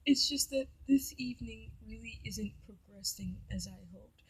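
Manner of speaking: imitating him, soft and halting; mock-earnest, near-whispered with long hesitations; hushed wounded confession.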